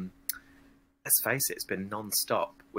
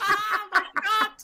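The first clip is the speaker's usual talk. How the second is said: much higher, louder, laughing through the words; laughing at own impression; 14 semitones higher, 3 dB louder than usual. Real, not acted. Guest laughing when her [name] impression goes Irish. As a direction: crack up at your own slipping accent. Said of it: "we're going to Irish there".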